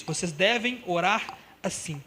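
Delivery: each word laid down slowly; slow emphatic quote. Acted. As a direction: read Jesus' words slowly for weight. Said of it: "Jesus diz". (citação lenta e enfática)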